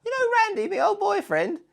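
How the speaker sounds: squeaky mocking girlfriend; mock woman's voice. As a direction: falsetto girlfriend impression. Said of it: "drop something like that".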